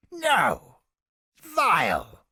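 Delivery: disgusted outburst. Acted. Recoils in disgust at image.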